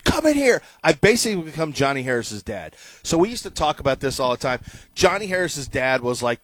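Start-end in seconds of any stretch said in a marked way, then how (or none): 0.0-0.6 high called-out summons; calling to his wife from the other room, high and loud. Retold or imitated. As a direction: call her into the room. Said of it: "My wife, I said".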